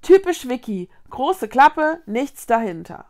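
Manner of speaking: heated, dismissive put-down; scornful taunt. A narrator's reading. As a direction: jeer at her. Konrad taunts Vicky in quarrel.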